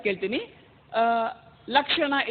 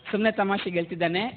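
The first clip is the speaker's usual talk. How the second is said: ordinary delivery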